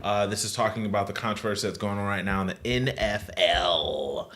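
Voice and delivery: normal talking voice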